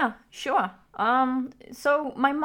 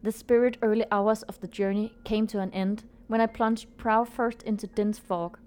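story narration. A narrator's reading. Reads the story over music.

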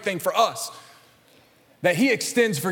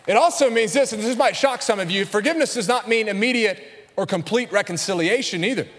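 ordinary talk

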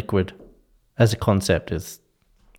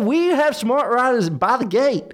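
obnoxious American tourist; 14 semitones higher than usual. Pretend. Australian host mocks American tourists on transport, high obnoxious American accent. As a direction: ham up a pushy American tourist. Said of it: "American accents ... obnoxiously asking for something".

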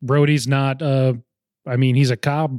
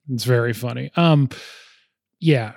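ordinary delivery

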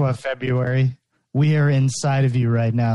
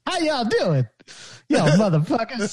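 rough comic impression, higher pitched; Eddie Murphy; 7 semitones higher than usual. [name] greeting the listeners, badly done celebrity impression. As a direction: have a shaky go at a comedian's voice, pitched up. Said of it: "trying my best Eddie Murphy impression".

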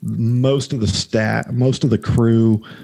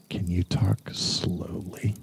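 low, slow, whispered; deep slow whisper; dropping voice deep; 3 semitones lower, 9 dB quieter, slower than usual. Retold or imitated. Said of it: "in a deep voice".

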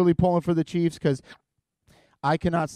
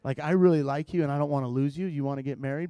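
mock casual proposal; 5 semitones lower, 6 dB quieter than usual. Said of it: "I was just like".

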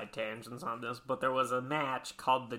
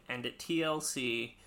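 ordinary talk, nothing marked

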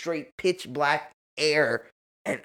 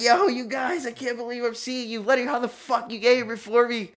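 higher, amazed relief; excited disbelief; 9 semitones higher than usual. Retold or imitated. Seeing Lenny got there first, amazed. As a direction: gush in disbelief at seeing them. Retold joyful reunion.

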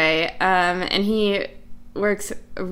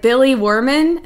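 loud question; 6 semitones higher, 9 dB louder than usual. Retold or imitated. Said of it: "he was like".

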